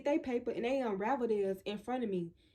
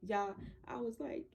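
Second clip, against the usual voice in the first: usual speaking voice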